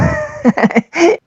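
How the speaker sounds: a short, high mocking laugh; scornful chuckle. Real, not acted. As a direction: laugh at the absurd claim. Preacher mocks the convert's claim.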